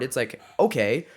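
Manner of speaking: ordinary talk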